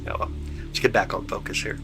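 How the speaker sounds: ordinary talk, nothing marked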